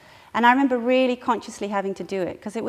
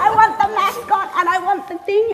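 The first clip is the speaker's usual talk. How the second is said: put-on higher, louder, fast childish whine; mock toddler demand, much higher and louder; imitating a demanding child; 10 semitones higher, 9 dB louder, faster than usual. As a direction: whine like a stubborn kid. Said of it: "he'd wedged himself".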